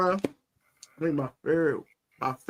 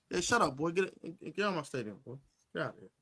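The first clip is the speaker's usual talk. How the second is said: much softer dismissal; smug low taunt; 11 dB quieter, faster than usual. Retold or imitated. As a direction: wave them off quietly. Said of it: "I said".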